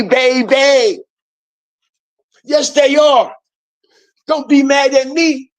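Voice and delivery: rally the viewers like a hype man; hyped up. Real, not acted. Hyped address to his viewers.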